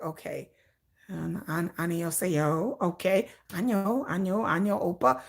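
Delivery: low, soft mumble over a translator; low hushed murmur sounding out Korean; friend's low murmur. Retold or imitated. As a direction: sound out Korean words under your breath, trying. Friend voiced typing Korean greetings.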